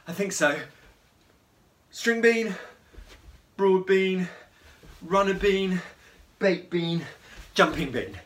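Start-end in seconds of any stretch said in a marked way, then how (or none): 2.0-8.2 loud, high game calls; called out loud. Shown as a demonstration. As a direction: call each bean out like a game leader. Calling out bean names again.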